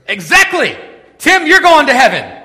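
high, booming mock-preacher shout; excited proclamation. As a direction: exclaim it like a triumphant showman. Acting the excited chapel speaker.

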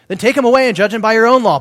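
exasperated command. Acted. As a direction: Pilate shoves the problem back at them. Pilate's reply re-read dramatized.

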